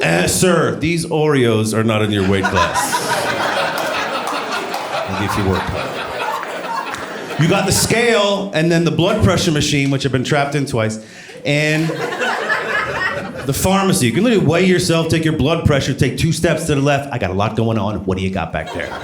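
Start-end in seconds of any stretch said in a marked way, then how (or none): 0.0-5.8 deadpan cashier. Retold or imitated. Cashier voiced in a bit. 17.1-18.9 lower, muttered pharmacist. Retold or imitated. Pharmacist voiced, low.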